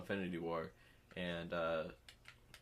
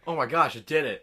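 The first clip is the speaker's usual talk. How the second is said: loud, high excited chatter; thrilled, loud and high; 5 semitones higher, 12 dB louder than usual. Real, not acted. Excited about app update.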